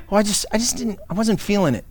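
lightly mocked plea for sympathy; soft excuse begging for empathy; a touch of wounded feelings, put on; sympathetic tones. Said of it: "cloaked in, you know, sympathetic tones".

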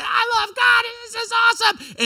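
put-on high, loud, whooping camp-kid yell; excited teen shout. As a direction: shout it like a hyped teenager at camp. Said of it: "everybody saying".

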